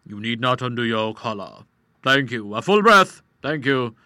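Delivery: a touch of an oily showman doctor; greasy doctor. Acted. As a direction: play the conjurer-doctor lightly. Doctor voiced in story.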